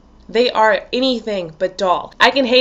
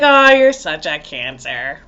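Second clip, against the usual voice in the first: slow, low drawn-out mock jibe; mock teasing; 6 semitones lower, 4 dB louder, slower than usual. People making fun of a Cancer, drawn-out teasing. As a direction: draw the jibe out in a lowered voice.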